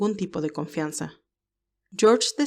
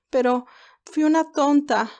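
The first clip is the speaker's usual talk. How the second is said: higher, slow, breaking into tears; on the verge of tears; 7 semitones higher, 4 dB louder than usual. Acted. Candy to Albert, blaming herself before sobbing, tearful. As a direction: let the words crumble as she starts to cry. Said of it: "Candy empezó a llorar desconsoladamente".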